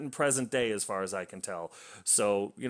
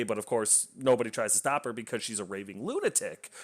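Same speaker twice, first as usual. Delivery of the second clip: normal talking voice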